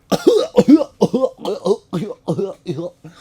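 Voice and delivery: loud, high cartoon retching; mock throwing up. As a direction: heave out repeated goofy yelps. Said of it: "throw up and you're like".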